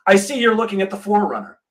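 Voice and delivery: lightly voiced pushy salesman; mock eager salesman. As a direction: ham up a young salesman's opener. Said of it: "walks over and says".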